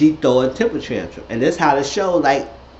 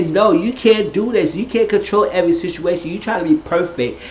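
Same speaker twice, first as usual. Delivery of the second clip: acted scolding; 6 semitones higher than usual. Acting Eugene checking Toya firmly.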